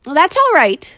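put-on high, loud boy; boy's voice. A narrator's reading. Boy answering the maid, high and loud. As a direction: reassure her. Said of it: "said the boy".

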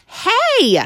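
sharp, high, loud cry of surprise; startled at a mouse; surprised hey. A narrator's reading. Boy spotting the mouse, surprised yelp. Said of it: "whispered the boy ... surprised".

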